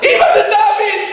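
high, pleading cry of a son. Retold or imitated. Son begging mother on the phone, crying out. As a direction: call out desperately, high-pitched. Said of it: "ושמעת אותך צועק".

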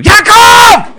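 a huge, high shout of the son's name; furious shout. Retold or imitated. Father yelling at his running son, loud high shout. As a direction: yell the name to freeze him on the spot. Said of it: "נתתי צעקה".

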